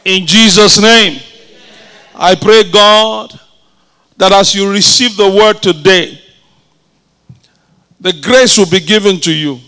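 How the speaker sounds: a hushed pastor's prayer. Acted. Pastor praying over the congregation, hushed and earnest. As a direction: pray it over them, earnest and low.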